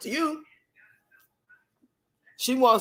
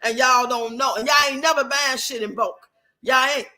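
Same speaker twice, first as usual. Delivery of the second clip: normal talking voice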